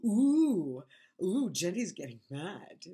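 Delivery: loud, high, drawn-out schoolyard teasing; exaggerated "ooh" of mock alarm; mocking taunt. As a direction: imitate a bully winding someone up. Retells coworker taunting her.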